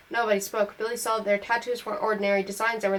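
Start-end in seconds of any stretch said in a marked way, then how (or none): none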